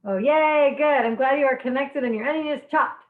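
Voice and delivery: normal talking voice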